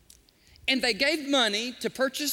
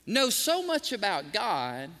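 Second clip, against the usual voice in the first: ordinary delivery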